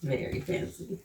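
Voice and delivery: whispered echo; a hushed little echo. Real, not acted. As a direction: breathe it back softly. Child whispers, adult echoes.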